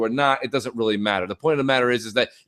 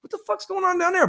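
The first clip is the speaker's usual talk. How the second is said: retold bafflement; 3 dB quieter than usual. Storyteller recalling shouts down the hall, retold high-pitched bafflement. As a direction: wonder at the racket in a raised pitch. Retells his puzzled reaction to shouting.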